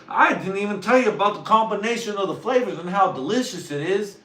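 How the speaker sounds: loud, high gushing over food; hammed-up foodie raving; excited fan. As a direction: sell it like the best thing ever. Fan defends his love loudly.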